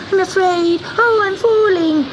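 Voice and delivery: high, frightened kitten cry; a small cat slipping and scared; terrified kitten. Acted. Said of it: "sobbed Gobelino".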